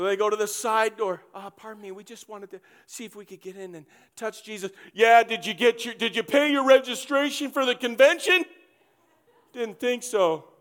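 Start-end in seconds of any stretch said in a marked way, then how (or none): 1.3-4.7 much softer, apologetic asking; soft sheepish pardon me; timid plea. Acted. Friends timidly asking at side door. 4.9-10.5 louder, smug bureaucrat; mild mock gatekeeper sneer; snooty official. Pharisee mocking registration.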